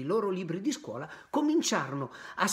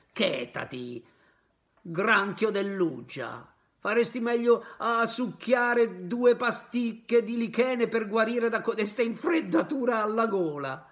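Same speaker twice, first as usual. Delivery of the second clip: slow, higher, louder mocking puppet; cheeky retort; 6 semitones higher, 5 dB louder than usual. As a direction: taunt the crab with mock advice. Pinocchio mocks the crab.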